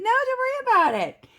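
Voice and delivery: sweet reassuring phone-agent voice; customer service voice. Retold or imitated. Her own bright phone voice.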